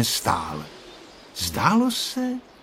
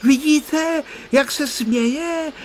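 excited shout; much slower than usual. A narrator's reading. Honzík excitedly pointing out the kite's smile. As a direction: blurt it out thrilled. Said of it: "vykřikl vzrušeně Honzík".

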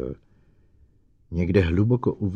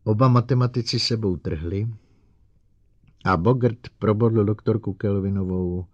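ordinary delivery, nothing marked

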